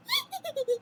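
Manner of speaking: high excited witch cackle. Acted. Witches cackle at Jack, high and gleeful. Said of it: "cackling with excitement".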